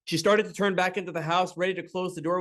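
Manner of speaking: plain reading voice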